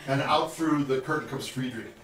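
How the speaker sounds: ordinary talk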